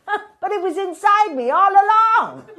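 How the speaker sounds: high, loud cry of delight; triumphant punchline. Acted. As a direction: crow it with theatrical joy.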